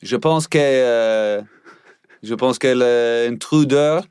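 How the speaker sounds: put-on drunk French cop voice; slurred, slow French accent. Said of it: "be a French inebriated police officer".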